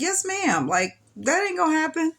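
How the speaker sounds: high, eager yes-ma'am to the nurse; eager promise. Retold or imitated. Young mother promising the nurse it won't happen, eager and high. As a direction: promise it brightly, pitch up.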